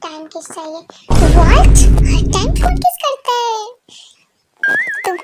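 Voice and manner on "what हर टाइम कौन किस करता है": very loud what, then higher indignant question; shocked shout. Acted. Wife shocked at constant kisses demand, loud shout. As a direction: burst out in disbelief.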